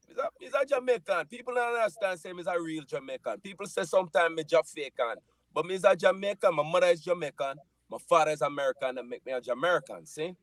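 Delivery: thick Jamaican patois, sing-song and high; playful Jamaican accent on request. Shown as a demonstration. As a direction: lay on the Jamaican accent for the crowd. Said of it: "Can we hear it? You want some Jamaican accent?".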